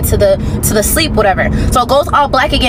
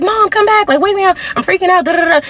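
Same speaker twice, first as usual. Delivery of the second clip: high, pleading call to mom; panicked plea; 7 semitones higher than usual. Retold or imitated. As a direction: beg mom, higher and urgent.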